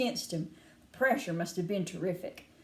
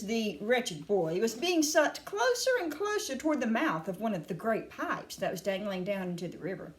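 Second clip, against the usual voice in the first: ordinary delivery, nothing marked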